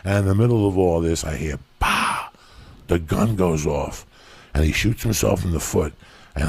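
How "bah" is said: sharp breathy bang noise; unvoiced gunshot bang. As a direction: spit out the gunshot. Gunshot imitated in story.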